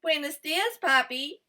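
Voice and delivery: cheerful little hello to the farmer; lightly voiced eager guinea pig greeting the farmer; Kui greeting brightly. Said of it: "said Kui".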